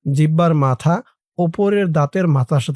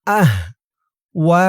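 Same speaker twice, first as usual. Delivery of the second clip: আহওয়া broken by a pause after আহ; split syllables; much slower than usual. Shown as a demonstration. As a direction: say আহ, wait, then a long ওয়া. Teacher splits word in two.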